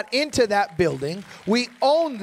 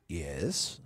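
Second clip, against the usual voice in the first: much lower, booming self-important "Yes... It is I"; deep reverend voice; 13 dB quieter than usual. Retold or imitated. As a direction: drop into a grand deep pastor voice. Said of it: "my voice changes".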